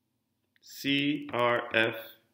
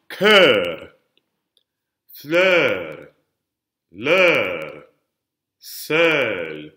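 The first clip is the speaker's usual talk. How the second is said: louder, isolated "Cœur, fleur, l'heure", each swelling on its final syllable; last-syllable emphasis; 6 dB louder, much slower than usual. Shown as a demonstration. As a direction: model each word from "Cœur" to "couleur" for repetition, leaning on the last syllable. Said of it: "putting all that emphasis on the last syllable".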